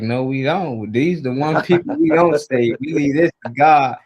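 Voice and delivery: imitated adamant objection; firm refusal. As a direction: lay down the rule emphatically, voicing someone else. Mentor's firm refusal, retold.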